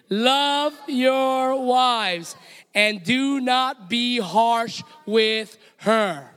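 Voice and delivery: very slow, every word weighted; a congregation repeating with conviction, slowly. Shown as a demonstration. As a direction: stretch "Love your wives and do not be harsh with her" out slowly. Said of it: "man, say it louder".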